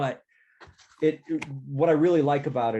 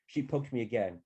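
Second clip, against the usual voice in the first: put-on goofy teen narrator; 3 semitones higher than usual. Taco gushes about asking Maggie to prom, goofy voice. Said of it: "It's just a goofy, goofy voice".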